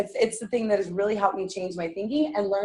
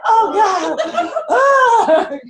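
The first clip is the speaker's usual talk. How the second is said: mock horror; 10 semitones higher, 6 dB louder, much slower than usual. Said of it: "We're like".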